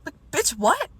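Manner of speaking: high, loud disbelief; incredulous. Real, not acted. Reacting to the date calling her hairy, incredulous. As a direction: throw it back, offended and incredulous.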